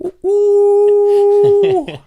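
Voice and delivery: 아주 높고 큰 소리로 터지는 웃음과 비명 같은 우우; 웃음이 터져 높은 비명으로 우우; wild shriek laugh. Real, not acted. Wild cackle over his own joke.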